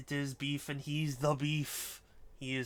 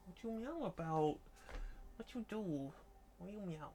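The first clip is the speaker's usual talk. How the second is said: higher, cooing questions to a cat; playful sing-song at the meowing cat; 7 semitones higher than usual. Real, not acted. Baby-talking the meowing cat.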